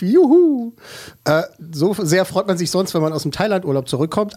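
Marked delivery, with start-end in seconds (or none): 0.0-0.7 loud high whoop of joy; cheering. Pretend. Cheering after negative tests.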